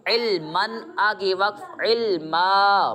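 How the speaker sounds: علمن said first, then علما with a long final a at the stop; pausal form demo. Shown as a demonstration. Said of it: "میں مثال دیتا ہوں".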